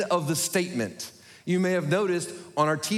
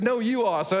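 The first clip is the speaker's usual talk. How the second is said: lightly voiced polite British butler; high, courteous British accent; a hint of British servant; British phone voice; 6 semitones higher than usual. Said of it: "with my British talking phone".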